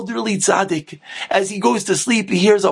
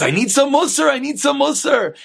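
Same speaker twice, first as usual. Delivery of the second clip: higher-pitched acted insistence; old man's mock protest, pitched up; insistent protest; 7 semitones higher than usual. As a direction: plead your need, twice over. Old man protesting to his wife.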